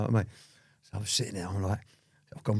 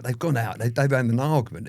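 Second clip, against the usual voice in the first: ordinary delivery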